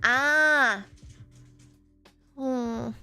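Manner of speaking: ordinary delivery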